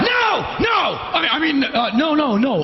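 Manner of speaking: high-pitched, alarmed protest; panicked refusal. Retold or imitated. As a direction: blurt it out, then scramble to recover. Frightened director and assistant refuse.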